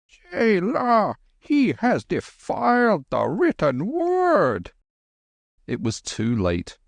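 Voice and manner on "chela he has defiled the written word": high, slow and solemn; slow high holy man's rebuke; outraged old Lama. Acted. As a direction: proclaim the sacrilege slowly.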